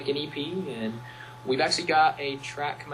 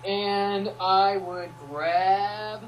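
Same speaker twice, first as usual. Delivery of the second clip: slow, higher, louder, stretching the words out; drawn-out suggestive tease; 7 semitones higher, 7 dB louder, much slower than usual. Shown as a demonstration. Listing what he'd save, teasing a cheeky item. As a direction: dangle it like something naughty is coming. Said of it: "well, that's not appropriate".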